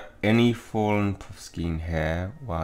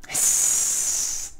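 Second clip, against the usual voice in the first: imitated angry cat hiss; long voiceless hiss like a cat. Player voicing Missus Norris the cat, hissing. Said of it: "without a hiss".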